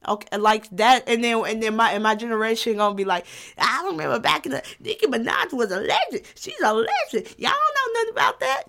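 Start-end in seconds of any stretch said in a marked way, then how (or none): 3.6-8.6 rising into a high mock elder voice; playing an old-timer lecturing the young. Future old-timer schooling the kids, climbing higher.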